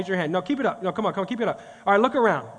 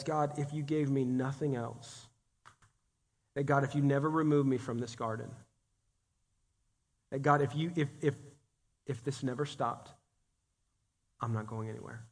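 soft surrender; 7 semitones lower, 10 dB quieter than usual. Retold or imitated. Quoting surrendered prayer.

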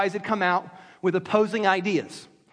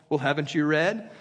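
lower, condescending haven't you read?; insulting; 7 semitones lower than usual. Retold or imitated. Jesus needling the Pharisees, condescending. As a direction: talk down to learned men. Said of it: "Which was insulting".

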